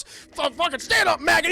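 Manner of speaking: loud, high bellowing drill sergeant; drill sergeant bark. Retold or imitated. The drill sergeant rousing Cruise on the floor, loud bark. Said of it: "Cruel Drill Sergeant".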